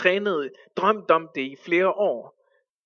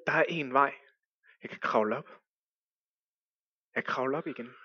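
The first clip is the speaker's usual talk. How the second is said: lower, soft inner voice; inner thoughts; 4 semitones lower, 8 dB quieter, faster than usual. Acted. Climber talks himself into climbing back, low and soft. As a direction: think it to yourself.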